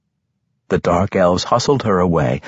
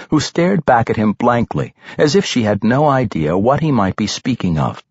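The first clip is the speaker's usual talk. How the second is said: ordinary delivery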